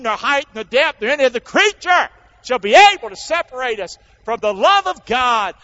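loud, ringing scripture recitation that swells to the end; full-force preaching. Acted. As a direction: proclaim each "nor" like a battle cry. Recites Romans eight aloud.

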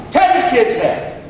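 usual speaking voice

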